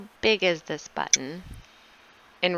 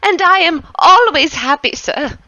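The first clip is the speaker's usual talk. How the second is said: higher, louder cheerful servant; 7 semitones higher, 4 dB louder than usual. Acted. Servant Anton tells his master he is always happy, chirpy. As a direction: beam relentlessly happy.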